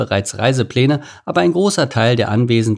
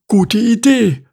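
very high, louder ostrich voice; Stanislav; 12 semitones higher, 5 dB louder, slower than usual. A narrator's reading. Ostrich accepting meerkat's advice, high bird voice. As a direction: squawk it like a keen bird.

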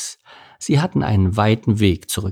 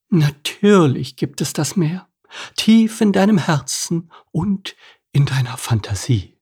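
put-on higher, dreamy old crab lady; a dreamy crab's voice, pitched up; crab Edith; 7 semitones higher than usual. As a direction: tell it like a fond old crab remembering.

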